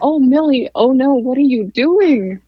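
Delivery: loud, high mock panic; alarmed protector. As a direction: cry out like a worried husband rushing to help. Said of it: "his hero protective mode was like".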